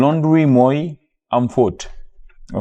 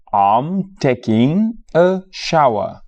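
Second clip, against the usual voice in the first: slow model phrase; 4 semitones higher, much slower than usual. Shown as a demonstration. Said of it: "teacher models the phrase".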